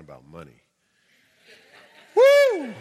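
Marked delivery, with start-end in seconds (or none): 2.1-2.7 whooping cheer; loud high whoop. Real, not acted. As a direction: let out a joyful woo. Energizing the crowd.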